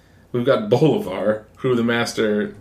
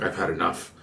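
quiet; 6 semitones lower, 9 dB quieter than usual. Retold or imitated. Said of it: "be like".

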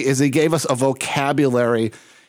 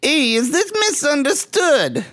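high, drawn-out puppet bully voice; slow high-pitched phone greeting in character; Randy; 11 semitones higher than usual. Acted. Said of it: "it's Randy".

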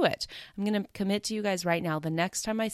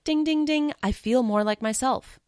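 high, louder chiming exclamation; mock bell of realization; 7 semitones higher, 5 dB louder than usual. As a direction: ring it out like a winning bell. Imitates bell of sudden realization.

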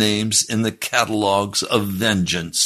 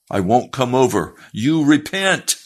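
firm retold refusal, rising in pitch; stern command; 6 semitones higher, 4 dB louder than usual. Preacher refusing the sinner's plea, acted stern command. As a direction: order him hard, slowing on the last words.

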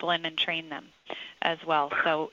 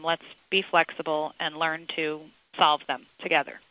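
ordinary talk, nothing marked

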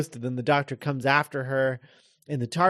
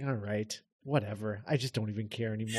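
defeated sigh; 4 semitones lower, 10 dB quieter than usual. Retold or imitated. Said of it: "He's like".

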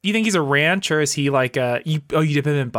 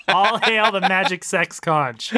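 usual speaking voice

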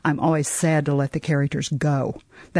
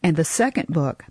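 ordinary delivery

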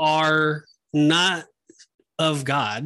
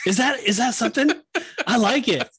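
high, laughing and a bit faster; laughing, giggly; 6 semitones higher than usual. Real, not acted. Cohost laughing at his own slang cray cray, giggling.

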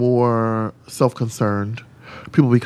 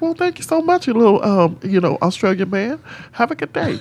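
much higher, sugary mock politeness; mock sweet thank-you; 9 semitones higher than usual. Drag queen mock-thanking the stylist sweetly. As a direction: gush with fake sweetness.

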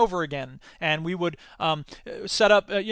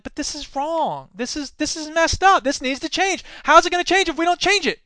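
high-pitched, insistent objection; indignant protest; 8 semitones higher than usual. Retold or imitated. Young activist refusing to go along, indignant. His indignant reply to cynics.

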